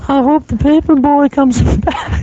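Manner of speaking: high cartoonish voice, laughing through; goofy squeaky character voice; silly high voice. Goofy bit between hosts.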